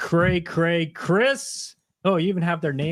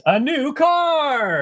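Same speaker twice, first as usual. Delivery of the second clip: higher, louder and slower, a hammed-up "new car" cheer; mock game show excitement; 10 semitones higher, 5 dB louder, much slower than usual. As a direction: shout it like a game show host teasing the chat. Reading a viewer's silly guess.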